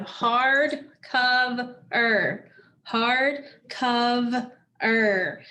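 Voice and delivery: hard, cov, er said apart; split into syllables. Shown as a demonstration. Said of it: "We have three syllables again".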